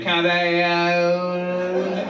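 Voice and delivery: drawn-out Louisiana drawl, words run together; southern drawl. Retold or imitated. Counter girl taking an order at Burger King, in a southern drawl. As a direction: slur it into one long southern word. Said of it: "They also have a southern drawl".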